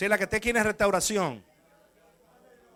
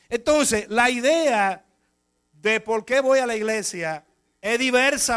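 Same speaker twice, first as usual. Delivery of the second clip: preaching; 2 semitones higher, 6 dB louder than usual. Acted. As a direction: drive the point home from the pulpit. Preaches about repentance.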